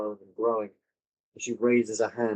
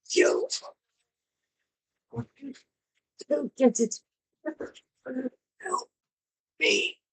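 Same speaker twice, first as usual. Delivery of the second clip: high, pleading cry for help; desperate shout; slower than usual. Acted. Gillian, crushed by the vine, crying high-pitched for help. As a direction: call out in panic. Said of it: "But Gillian just shouts out".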